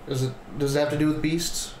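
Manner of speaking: usual speaking voice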